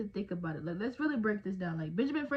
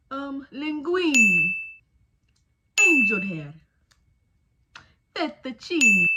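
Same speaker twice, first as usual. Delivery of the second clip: loud, hammed-up Italian accent; exaggerated Italian accent for the drinking game; 4 semitones higher, 11 dB louder, slower than usual. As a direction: belt out pasta names in a mock Italian accent. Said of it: "without messing up the accent".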